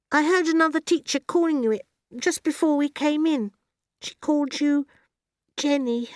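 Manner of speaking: put-on high child voice, careful; high-pitched, slightly hesitant girl; little girl explaining. A narrator's reading. Voices Matilda in the story.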